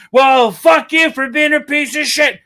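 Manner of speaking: high, loud mock yell; mock shout. Interviewer attacking the guest, a high loud mock shout. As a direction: lay into him with a shout. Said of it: "He's like".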